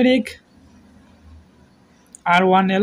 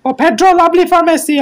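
quick, higher burst of excitement; excited call; 6 semitones higher, 3 dB louder, faster than usual. Real, not acted. Commentator reacting to Pedro's pass, quick excited call. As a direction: rush the words as the chance opens. Sudden Barcelona attack.

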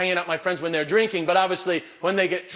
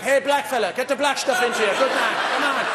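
boisterous cheering; 5 semitones higher than usual. Pretend. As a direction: egg him on like a rowdy local. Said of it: "It was like".

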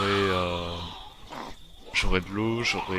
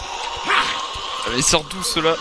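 sudden high yelp of surprise, then louder; startled surprise; 8 semitones higher, 4 dB louder, slower than usual. Real, not acted. Gamer startled by zombies popping up, surprised yelp. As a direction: caught off guard by new zombies. Zombies appear unexpectedly in game.

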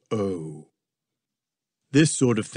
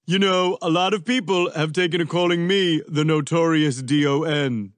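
higher, lightly voiced Trump; boastful Trump; 5 semitones higher, 3 dB louder than usual. A narrator's reading. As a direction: brag casually in character.

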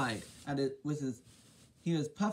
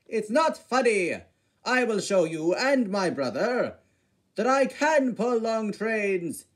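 indignant; 4 semitones higher, 7 dB louder than usual. Acted. Gordon protests to laughing James.

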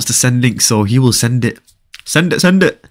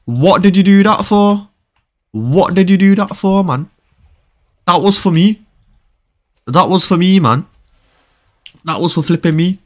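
high-pitched complaint; indignant protest; 5 semitones higher than usual. Real, not acted. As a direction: protest the stolen prize in a raised pitch. Protests at losing his kill.

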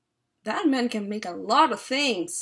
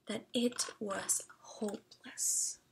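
softer, whispered narration; hushed; 11 dB quieter than usual. A narrator's reading. Reader hushing the story for the child. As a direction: drop to a whisper for suspense.

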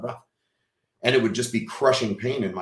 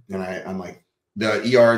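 ordinary delivery

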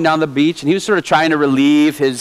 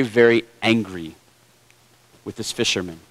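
usual speaking voice

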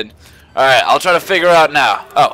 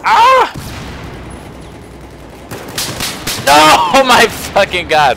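startled scream; 9 semitones higher than usual. Real, not acted. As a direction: yelp in panic then laugh. Player dies in game.

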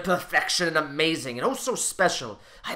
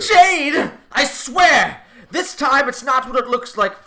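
high, loud pleading cry; panicked protest; 7 semitones higher, 7 dB louder, slower than usual. Acted. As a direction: beg her to believe you. Husband caught, protests.

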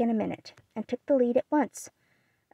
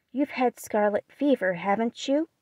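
ordinary delivery, nothing marked

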